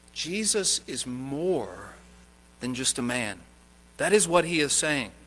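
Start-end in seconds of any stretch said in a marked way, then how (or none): 0.1-3.4 soft, slow, weighty; slow solemn emphasis. Real, not acted. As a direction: let each word land slowly and quietly. Solemn slow preaching point.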